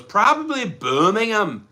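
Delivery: high, drawn-out mock Brummie; put-on Birmingham twang; mock Birmingham accent. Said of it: "that's not a Birmingham accent".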